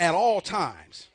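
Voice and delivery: booming, stretched-out declaration; call and response. Shown as a demonstration. Preacher feeds congregation the next phrase, loud call and response. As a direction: land it loud and very slow for repeating. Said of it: "Say this with me".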